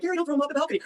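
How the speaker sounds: alarmed high voice, acting out a plea; retold alarmed plea. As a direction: imitate someone frantically begging him to stop. Girlfriend pleading in retold fight.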